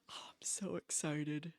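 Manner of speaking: breathless whispered thrill; excited whisper. Real, not acted. Streamer to chat about the new game, whispering excitedly.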